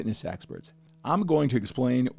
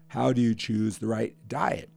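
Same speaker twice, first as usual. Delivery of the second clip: ordinary talk, nothing marked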